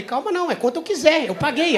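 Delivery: high-pitched mock indignation; indignant protest. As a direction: argue back like an outraged paying customer. Acted argument with flight attendant.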